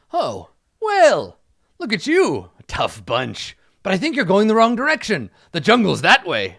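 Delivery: high, loud, hearty knight voice; pompous knight. Acted. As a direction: hail the travelers and advise them grandly.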